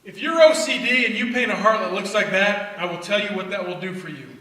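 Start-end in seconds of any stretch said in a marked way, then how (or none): none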